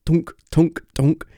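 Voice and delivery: clunk it out like a heavy power switch thrown three times; playful sound effect of big switches; imitated switch clunks. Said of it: "as that happens".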